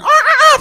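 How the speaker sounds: loud, very high rooster squawk; imitated crowing bursting out. Imitates rooster crowing under floor.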